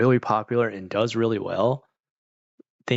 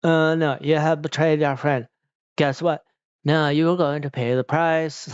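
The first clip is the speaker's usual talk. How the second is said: higher, stilted bad-actor recital; mock wooden drama performance; atrocious acting; 5 semitones higher than usual. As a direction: recite it like memorized lines, pitched up. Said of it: "the acting in Hell Girl is freaking atrocious".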